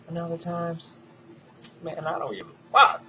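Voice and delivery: loud defiant outburst to the camera. Real, not acted. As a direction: fling it out defiantly. Defiant aside to viewers.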